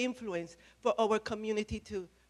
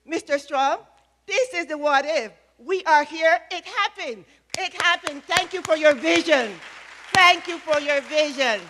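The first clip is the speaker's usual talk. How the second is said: much louder, higher, thanking with real fire; full energy; 6 semitones higher, 10 dB louder than usual. Real, not acted. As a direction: call out your thanks to Strom with everything you have. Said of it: "and with all of the energy".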